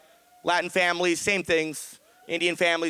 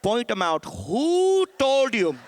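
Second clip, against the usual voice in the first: drawn-out, loud and higher WHO told you; mom's slow loud demand; 4 semitones higher, 4 dB louder, slower than usual. Retold or imitated. Comic telling his mom's angry demand, slow and loud. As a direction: stretch each word out like a furious mom. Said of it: "She's like".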